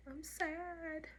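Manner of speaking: high, teary voice breaking over the words; retold tearful plea. Telling the nurse after surgery, high teary voice. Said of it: "And I was just crying".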